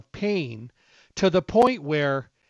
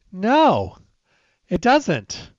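louder, higher, flatly dismissive; emphatic no; 5 semitones higher, 5 dB louder, much slower than usual. Real, not acted. Answering people who count burger lettuce, a loud incredulous no. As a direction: swat the silly excuse away.

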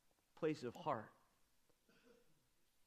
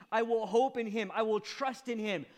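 loud, pleading, voice raised high; impassioned cry; 6 semitones higher, 7 dB louder than usual. Acted. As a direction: cry it out like a desperate man. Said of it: "he says".

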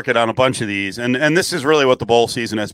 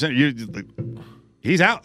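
normal talking voice

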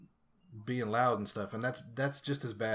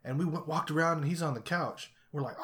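ordinary talk, nothing marked

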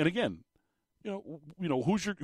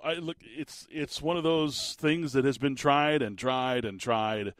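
ordinary delivery

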